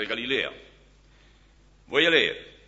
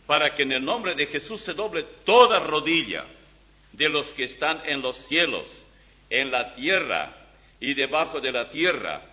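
scripture reading; 4 semitones higher, 5 dB louder than usual. Acted. Reads Philippians aloud from Bible.

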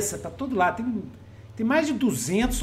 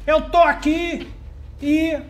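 put-on Eudeucoron accent; 6 semitones higher, 4 dB louder than usual. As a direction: speak with the stately Eudeucoron accent. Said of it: "Eudeucoron fala desse jeito".

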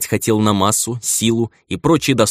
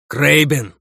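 high, sharp call of a name; 7 semitones higher than usual. Acted. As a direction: call him over urgently. Archmage calls out the hero's name.

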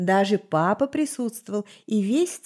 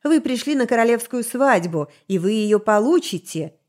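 deafening roar; 3 semitones higher, 4 dB louder than usual. Acted. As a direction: roar it out to freeze the room. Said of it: "оглушительно не рявкнул".

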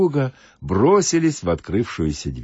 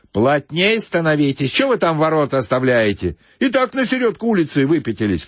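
higher pitched call from the back of the queue; shout from behind; 8 semitones higher, 3 dB louder than usual. Acted. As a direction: call out to the crowd ahead. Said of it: "крикнули сзади".

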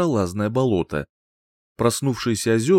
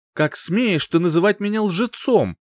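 indignant exclamation; 9 semitones higher than usual. A narrator's reading. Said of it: "воскликнул Похьелец".

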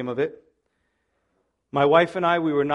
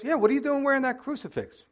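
pushy church lady; 7 semitones higher, faster than usual. Retold or imitated. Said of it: "She goes".

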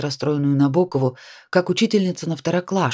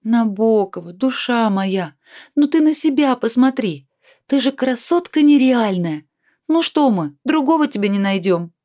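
coaxing encouragement; 7 semitones higher than usual. Acted. Comforting, coaxing friend.

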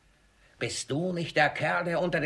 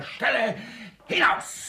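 angry order; 7 semitones higher than usual. Acted. Miser orders servant out, angrily. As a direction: shout him out the door.

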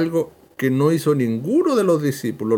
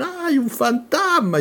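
high, drawn-out mock cry of alarm; frightened cry; 10 semitones higher than usual. Preacher voices the terrified disciples seeing Jesus, frightened cry. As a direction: cry out in fright, imitating the panicked men. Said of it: "Y empiezan a gritar".

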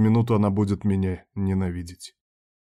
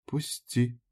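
soft, weak, pitched-up plea; quiet whisper; 3 semitones higher, 6 dB quieter than usual. A narrator's reading. Tearful woman asks him to let go, quiet whisper. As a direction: barely voice it, as if drained. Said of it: "тихо шепнула".

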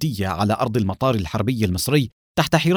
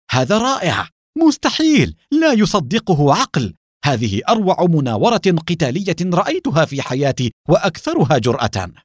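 astonished cry; 5 semitones higher than usual. Acted. Alexei watching Adham's dogfight manoeuvre, crying out astonished. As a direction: gasp it out in disbelief at the manoeuvre. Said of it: "صاح فيها أليكسي بذهول".

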